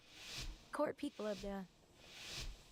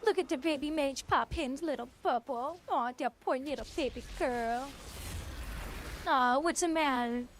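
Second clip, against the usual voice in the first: mock sympathy; 3 semitones higher, 3 dB louder than usual. Real, not acted. Taunting enemy player in game.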